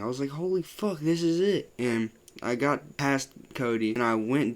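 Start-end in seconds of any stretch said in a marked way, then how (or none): 0.3-1.7 scared. Retold or imitated. Retold dying panic in trip.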